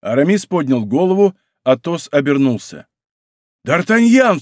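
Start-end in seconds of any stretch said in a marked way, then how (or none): 3.6-4.4 high, loud exclamation; astonished cry. A narrator's reading. Athos recognising his captor friend, astonished cry. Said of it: "вскричал Атос".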